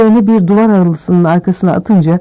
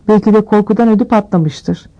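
ordinary delivery

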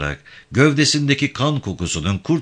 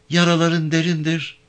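high-pitched woman's character voice; concerned woman; 9 semitones higher than usual. Acted. Avke looking at Yavan's wounds, concerned woman. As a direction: worry aloud in a high woman's voice.

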